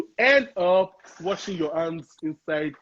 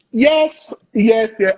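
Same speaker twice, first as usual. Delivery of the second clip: emphatic yes; 3 semitones higher, 9 dB louder, much slower than usual. Real, not acted. Emphatic confirming fun fact.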